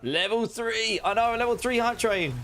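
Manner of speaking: high excited announcement. Real, not acted. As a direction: call out the hype train level. Excited hype train announcement.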